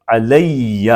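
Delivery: علیہ very slow, doubled ya stressed with zabar; slow demo of alayya. Shown as a demonstration. Teacher models علیہ slowly for the class. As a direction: stretch علیہ and lean on the doubled ya. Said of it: "دوسری یا پہ زبر بولیں گے".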